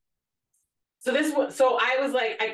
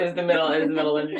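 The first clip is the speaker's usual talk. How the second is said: ordinary talk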